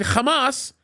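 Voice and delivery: Hamas as xə-mahs with a throaty kh; mock it the Hebrew way, guttural start; Hebrew pronunciation. Shown as a demonstration. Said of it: "pronouncing the name ... the way the Hebrews do it".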